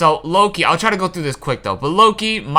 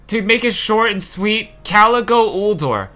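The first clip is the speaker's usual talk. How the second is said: ordinary talk, nothing marked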